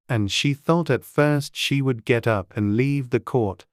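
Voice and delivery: plain reading voice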